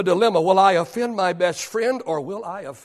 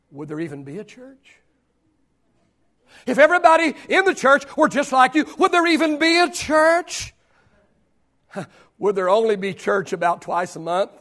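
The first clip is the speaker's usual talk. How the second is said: ordinary talk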